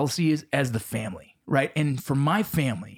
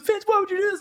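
upset sister. Retold or imitated. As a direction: play the scolding twin sister. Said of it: "She goes".

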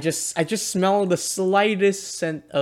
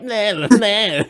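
drawn-out, higher nah-nah jeering; mock bratty nagging noise; 4 semitones higher, 3 dB louder, much slower than usual. Imitating a friend drowning out the intro, mocking nah-nah. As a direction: mimic someone heckling over you, stretched out. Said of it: "And you're like".

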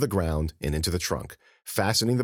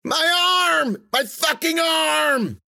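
high, loud agonised scream; screaming in pain; 6 dB louder, much slower than usual. A narrator's reading. Rufus screaming about his broken arm, pain. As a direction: howl out the injury. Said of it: "Rufus screamed".

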